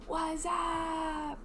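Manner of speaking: high, stretched-out "what's up"; drawn-out greeting. Acted. Co-host showing how she read the greeting, drawn out high. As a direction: draw it out long and high. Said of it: "That's how I read it".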